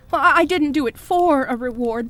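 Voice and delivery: ordinary talk, nothing marked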